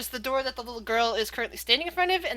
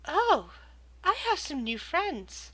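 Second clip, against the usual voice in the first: put-on higher, softer voice of a small girl; little girl voice; 5 semitones higher, 5 dB quieter, slower than usual. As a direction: speak sweetly as a creepy little girl. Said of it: "you hear a little girl's voice say".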